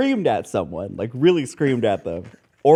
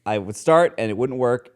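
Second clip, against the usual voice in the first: usual speaking voice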